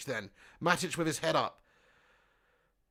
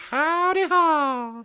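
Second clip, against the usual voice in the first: high, loud, drawn-out cartoon greeting; stretched high-pitched cartoon voice with a laugh; Mr Hanky; 12 semitones higher, 8 dB louder, much slower than usual. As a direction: sing out a cheery cartoon catchphrase. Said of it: "without thinking of South Park".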